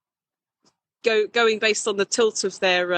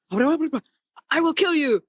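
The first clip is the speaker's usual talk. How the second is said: much higher, very slow dubbed threat; badly dubbed kung fu villain; 9 semitones higher, much slower than usual. Mocking a bad dub from old Hong Kong flicks, higher and very slow. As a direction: stretch it out like a lagging English dub. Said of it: "he'd always sort of be like".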